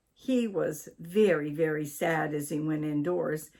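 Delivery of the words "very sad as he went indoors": plain reading voice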